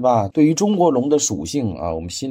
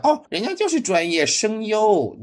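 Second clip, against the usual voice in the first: higher, smug know-it-all retort; mocking fans' defense; 10 semitones higher than usual. Critic mocking fans who defend the voice actors, snooty. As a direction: parrot the fans with a snooty lilt. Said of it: "就有很多人跳出来".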